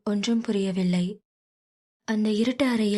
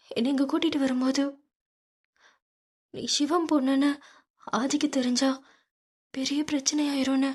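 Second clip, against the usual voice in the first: put-on higher young woman pleading; pleading daughter; 5 semitones higher than usual. Meera pleading with Aadhi for her father, higher voice. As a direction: beg him to spare her father.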